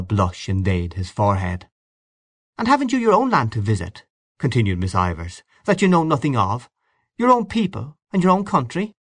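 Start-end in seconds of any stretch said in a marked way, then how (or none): 2.6-8.9 higher, insistent cross-examining; pressing challenge. A narrator's reading. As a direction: corner him with each question. Miss Ivors cross-examining.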